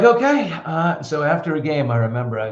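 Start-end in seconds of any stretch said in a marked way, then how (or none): 0.0-0.5 mock compliant okay. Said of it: "And I was like".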